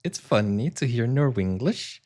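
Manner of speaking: Norwegian-English lilt, last word swooping up; sing-song Norwenglish; Norwenglish accent. Shown as a demonstration. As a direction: imitate a Norwegian speaking English, rising at the end. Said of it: "imitating the Norwe-, Norwenglish that I'm listening to".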